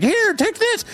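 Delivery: very high squeaky helper voice; squeaky Clucky. Acted. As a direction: toss the gift in a tiny high voice. Clucky tosses shoes.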